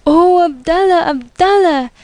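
high, emotional exclamation; storyteller voicing excited, loving children; children's fond cry. A narrator's reading. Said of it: "they cried as they stroked and petted him".

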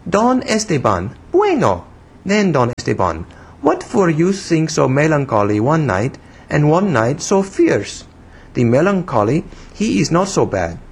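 put-on high, childish foreign-accented English; broken English in a little-girl pitch; broken English, infantine. A narrator's reading. Young woman scolding the man who startled her, childish foreign accent. Voices Pepita in dialogue.

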